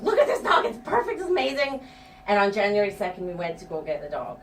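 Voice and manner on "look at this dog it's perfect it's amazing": higher, gushing over the dog; pitched-up excited gushing; gushing excitement. Retold or imitated. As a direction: rave to friends about the perfect dog. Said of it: "I was like".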